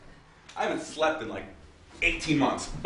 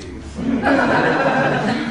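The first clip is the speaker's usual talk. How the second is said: real laugh; 10 semitones higher than usual. Real, not acted. Laughing at own joke.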